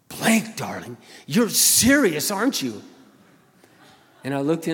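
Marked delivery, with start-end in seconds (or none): 0.1-2.8 stunned question. Retold or imitated. Dan asking, stunned.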